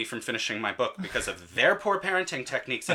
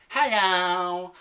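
a raised, bright little "Hello."; toddler hello, higher; 8 semitones higher, 5 dB louder than usual. Retold or imitated. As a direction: greet like a pestering toddler. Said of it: "Jasmine pops up again".

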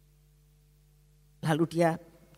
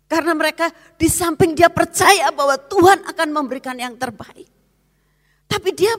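higher, louder, fervent sermon voice; higher, louder preaching; preaching at full force; 11 semitones higher, 10 dB louder than usual. Acted. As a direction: drive the point home. Preaching climax.

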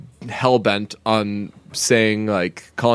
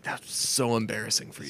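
much softer, pitying aside; embarrassed listener; 11 dB quieter than usual. Retold or imitated. Listener cringing at a friend's failed impression, hushed pity. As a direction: drop right down, embarrassed on their behalf. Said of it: "everyone who's listening is like".

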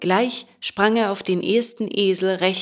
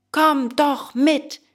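high, drawn-out call; crowd's shout; 7 semitones higher, slower than usual. A narrator's reading. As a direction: call out slowly and high, as excited boys would. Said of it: "schrien alle aus dem Wagen". (hoch und gedehnt rufend)

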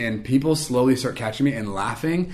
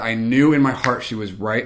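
ordinary talk, nothing marked

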